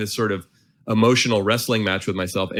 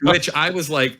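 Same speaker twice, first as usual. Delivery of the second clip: normal talking voice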